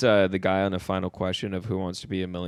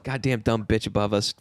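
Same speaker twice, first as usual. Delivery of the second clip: usual speaking voice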